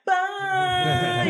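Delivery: high, loud sing-song bye; high mocking farewell; mock chorus bye. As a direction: wave them off in a high chirpy chorus. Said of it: "all the ladies in the crew were like".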